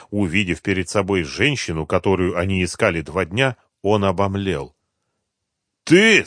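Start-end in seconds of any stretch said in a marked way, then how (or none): 5.8-6.3 loud, high menacing question; threatening. A narrator's reading. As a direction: ask it as a threat, pitched up. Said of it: "спросил он с угрозой в голосе".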